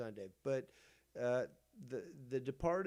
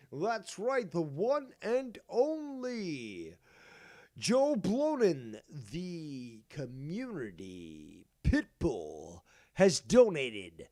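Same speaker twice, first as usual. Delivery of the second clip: booming, drawn-out ring announcer; grand, swooping showman delivery; hammed-up announcer; 6 dB louder, slower than usual. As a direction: announce it like a big show, stretching key words. Announces a donation.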